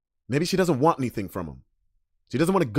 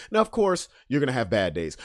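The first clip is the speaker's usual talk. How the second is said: usual speaking voice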